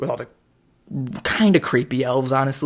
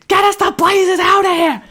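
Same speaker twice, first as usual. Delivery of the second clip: mock panicked shout; 9 dB louder than usual. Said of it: "So like".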